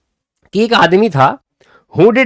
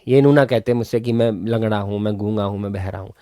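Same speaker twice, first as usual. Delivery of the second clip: low lament sinking away softly; acted lament; 6 semitones lower, 5 dB quieter than usual. Mocked man laments to God, low and fading. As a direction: let each item drop lower and quieter.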